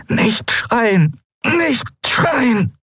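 high, strained pleading through pain; desperate pleading. A narrator's reading. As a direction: force the words out against agony. Desperate self-command in agony.

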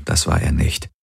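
ordinary delivery, nothing marked

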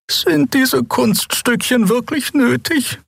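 very high, slow character voice; drawn-out high-pitched reproach; reproachful questioner. A narrator's reading. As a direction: ask it slowly in a high, put-on voice. Xea questions the pilot's stunts.

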